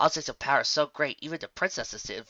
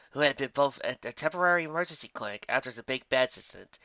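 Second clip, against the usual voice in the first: plain reading voice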